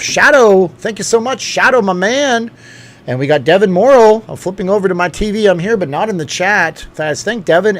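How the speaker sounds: high, loud, grateful hype; excited shout-out. Real, not acted. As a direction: call out supporters with big, high-pitched enthusiasm. Shouting out and thanking supporters.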